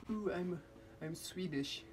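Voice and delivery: badly faked Swedish accent; mock Swedish voice. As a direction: put on a silly Swedish voice. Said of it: "I don't know what Swedish people sound like".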